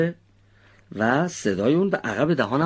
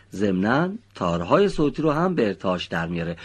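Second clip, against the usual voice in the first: normal talking voice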